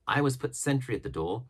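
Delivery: usual speaking voice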